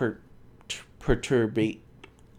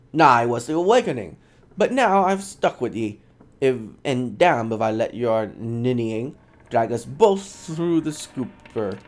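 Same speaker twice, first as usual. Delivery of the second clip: scornful Scottish brogue; Scottish accent; 5 dB louder than usual. Acted. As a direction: scoff at him in Scottish. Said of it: "But I can't do a Scottish voice".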